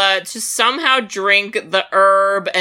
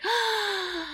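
a high, hushed gasp; soft surprised gasp; 11 semitones higher, 12 dB quieter than usual. Retold or imitated. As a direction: gasp in quiet awe. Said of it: "And he's like".

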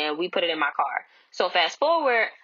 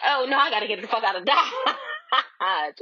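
slow, higher, laughing through it; laughing panic; 4 semitones higher than usual. Retold or imitated. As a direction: laugh while pretending to bolt in panic. Fleeing as he chases her.

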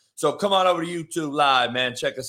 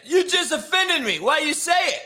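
incredulous outburst; 10 semitones higher than usual. Real, not acted. Incredulous retort to imagined insult.

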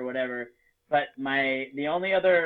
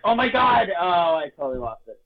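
mock shocked dismay; 3 semitones higher, 6 dB louder than usual. Waking up, remembering the dream.

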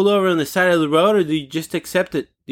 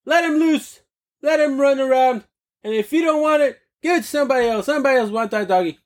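much higher, squeaky pet voice; voicing the dog Flapjack; 9 semitones higher than usual. Host voicing his dog against chained doggies. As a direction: speak for the dog as an upset pup. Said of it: "Flapjack has a lot of things to say".

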